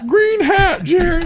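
very high, goofy squeal; tattling fan. Acted. Fan points out the heckler to Jerry, squeaky eager voice. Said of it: "one of those guys like".

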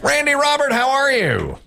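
high, sustained announcer greeting; excited hail. Real, not acted. Host greeting the next caller on air, excited hail. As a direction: call out the contestant's name with big energy.

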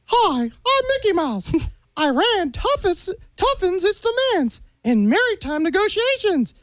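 enthusiastic cartoon mouse newsreader; Mickey Mouse. Guest reads Iran news to hosts, enthusiastic cartoon mouse. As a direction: read world news as an eager cartoon mouse. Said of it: "it's Mickey Mouse headline news".

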